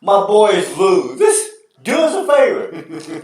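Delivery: high, loud, pleading with the TV; mock pleading rant. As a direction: scold the announcer, half joking. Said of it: "I'm like".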